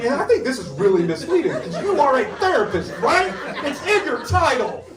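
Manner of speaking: mock-outraged whine; indignant complaint. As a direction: act wronged and catfished. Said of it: "I'm like".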